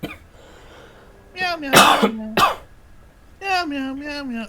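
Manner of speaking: high squeaky meowing cat; nervous cat voice. Acted. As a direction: meow like a startled kitten. Ringtone voiced as cat meows.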